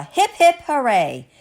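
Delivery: loud, rising hip-hip-hooray; cheer. Acted. Mr. Matu applauding the farmers, hearty cheer. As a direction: cheer it out with gusto. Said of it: "Matu gave a cheer".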